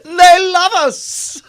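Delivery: high, slow, wailing fan voice; mock weeping fan. To the hosts, an adoring fan sobbing, high and slow.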